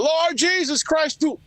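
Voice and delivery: high, slow hellfire preacher wail; mock fire and brimstone preacher cry; shouting preacher. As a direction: belt it like a Sunday radio preacher. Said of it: "preachers done go".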